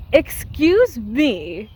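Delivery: slow, drawn-out indignant retort. Real, not acted. Fan answering the director's claim about Eustace, indignant. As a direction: stretch it out, affronted.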